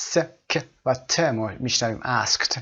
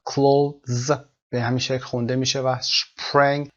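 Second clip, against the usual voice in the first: ordinary talk, nothing marked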